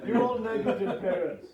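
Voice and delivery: loud, slow laughing accusation; mock-scold them through laughter. Real, not acted.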